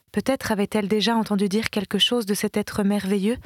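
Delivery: usual speaking voice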